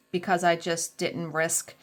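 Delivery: ordinary talk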